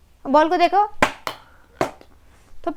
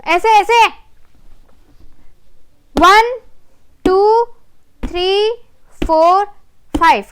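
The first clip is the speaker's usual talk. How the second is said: excited counting; 4 semitones higher, 5 dB louder, much slower than usual. Real, not acted. Cheering the child's count.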